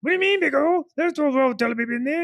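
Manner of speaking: put-on high, scolding girlfriend voice; wife's high mock voice.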